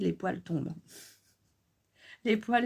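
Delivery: normal talking voice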